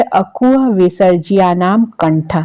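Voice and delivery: very slow, even recitation of the rule; teacher reciting a Sanskrit sutra. Shown as a demonstration. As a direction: spell out each word so students can memorise. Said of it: "तो ये जो सूत्र है".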